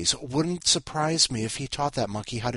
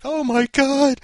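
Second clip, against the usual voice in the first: loud, high-pitched mock fan shriek; excited fan; 12 dB louder, slower than usual. Podcast host mimicking Todd's fans spotting him, excited mock shriek. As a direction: squeal it like a starstruck fan. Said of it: "all his fans are going to be like".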